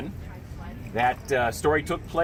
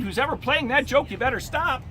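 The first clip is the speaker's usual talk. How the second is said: mild mock yell; 8 semitones higher than usual. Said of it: "he yelled outside".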